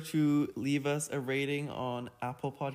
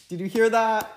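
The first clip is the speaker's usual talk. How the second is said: excited exclaim; 9 semitones higher, 13 dB louder than usual. Real, not acted. Exclaiming over the quote.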